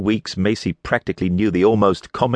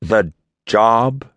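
scornful rising echo of the word; mock her word with a sigh; sarcastic; 5 semitones higher, 3 dB louder than usual. A narrator's reading. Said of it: "letting out a sarcastic sigh".

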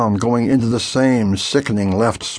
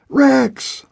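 much higher shout of a name over the radio; urgent call; 14 semitones higher than usual. A narrator's reading. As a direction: yell the name to wake him. Radio voice calling.

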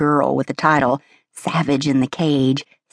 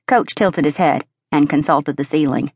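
usual speaking voice

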